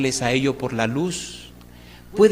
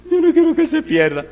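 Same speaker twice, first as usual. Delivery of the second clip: much higher, louder, pleading wife voice; imitating the wife; 6 dB louder than usual. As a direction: play the nagging señora, high and insistent. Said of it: "esta mujer pelea mucho". (imitando a la señora, aguda y quejosa)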